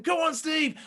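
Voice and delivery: mock crowd shout. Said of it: "they're shouting".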